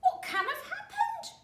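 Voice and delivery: put-on high, loud old woman; old wife voice. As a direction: squeak it out like a fussy old wife.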